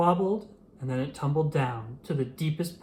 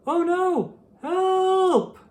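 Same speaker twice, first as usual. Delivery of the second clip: very high, louder frightened squeal; panicked cry for help; 6 dB louder, much slower than usual. A narrator's reading. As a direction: yelp it as you slip over the edge. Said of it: "But Big Al said".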